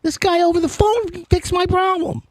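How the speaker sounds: amazed customer. Retold or imitated. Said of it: "they're like".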